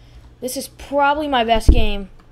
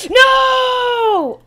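much higher, louder cry of loss; anguished shout; 12 semitones higher, 10 dB louder than usual. Real, not acted. Gamer eliminated at the end, higher loud anguished no. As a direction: yell as it all falls apart.

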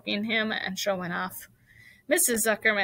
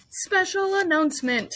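loud, high-pitched pompous announcer; pompous loudspeaker voice; 9 semitones higher, 5 dB louder than usual. A narrator's reading. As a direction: announce it with booming self-importance. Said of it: "in a pompous voice".

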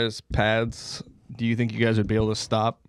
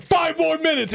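very high, sing-song ref call; hammed-up high ref voice; mock referee. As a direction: announce the penalty like a silly ref. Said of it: "the ref's like".

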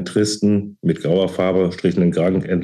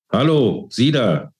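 calling out; 6 semitones higher, slower than usual. A narrator's reading. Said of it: "rief jemand hinter ihr".

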